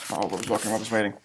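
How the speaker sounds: a mock-scared hush for the listening kids; hushed suspense. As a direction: lean in and hush, building suspense.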